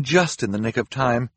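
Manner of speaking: ordinary delivery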